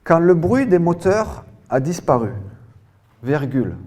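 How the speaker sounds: high, loud dictation in one full phrase; loud, carefully articulated dictation voice; dictation reading. Real, not acted. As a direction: project the sentence for a whole class.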